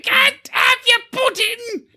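very high, drawn-out schoolmaster screech; hammed-up scolding British teacher; British schoolteacher. Quoting Another Brick in the Wall to co-hosts, screeching teacher voice. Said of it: "the teacher from, uh, Another Brick in the Wall".

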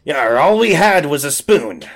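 salty pirate defence; pirate diplomat. Acted. Pirate defends botched amputation, pirate voice.

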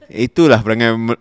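ordinary talk, nothing marked

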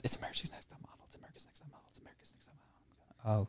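hushed, voiceless rumor going round; imitated whispers in a waiting room; excited whispering. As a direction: whisper it like a secret spreading.

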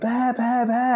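high-pitched fan squeal calling his name; mock screaming fans. Crazed fans calling out to Bear.

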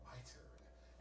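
very soft, near-whispered retort; hushed menace. Retold or imitated. Dreamer to gunman out of bullets, hushed menace. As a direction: say it under the breath before striking back. Quiet retort before firing back.

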